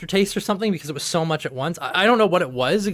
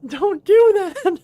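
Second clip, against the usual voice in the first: higher, slowing alarmed pleading; alarmed mom; 9 semitones higher than usual. Retold or imitated. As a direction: recoil at the mess, begging. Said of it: "It's like".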